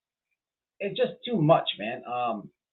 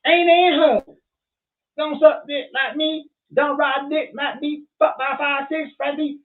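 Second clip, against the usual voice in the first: high, loud drawn-out mock taunt; acted-out taunt; 10 semitones higher, 6 dB louder, slower than usual. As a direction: holler it high and slow, hamming up the insult. Acting out grandma taunting in imagined fight.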